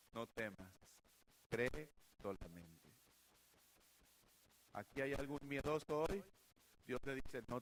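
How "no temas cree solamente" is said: soft and slow, calming a frightened father; gentle reassurance. Acted. Jesus softly tells the grieving father not to fear. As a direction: hush him gently, no rush.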